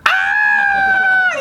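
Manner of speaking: gasp, then extremely high mock shriek; piercing put-on tantrum wail; imitated escalating cry. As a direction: imitate a toddler crying even harder. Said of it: "He cries even more".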